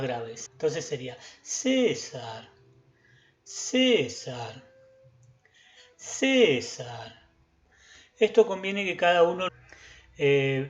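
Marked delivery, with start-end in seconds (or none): 1.4-7.3 "César" chanted slowly, CÉ high and long, sar low; high-low intonation. Shown as a demonstration. Teacher modelling a rhythm exercise, chanting CÉ high, sar low in "César". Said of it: "un tono más agudo".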